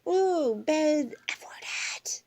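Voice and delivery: high gasp into a whispered want; breathy sneaky whisper; mock whisper. Grandmother voicing the kittens whispering to the child. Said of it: "They were like".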